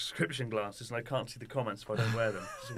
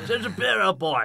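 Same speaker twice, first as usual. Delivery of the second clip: high, loud mock exclamation, old-boy style; hammed-up old chap; 7 semitones higher, 10 dB louder than usual. Host joking with his guest about ginger beer, hammed-up old chap.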